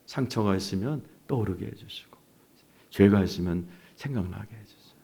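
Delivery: lightly hushed retold prayer; quiet petition to God; praying. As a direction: pray it low and soft. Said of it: "이 기도를 했어요".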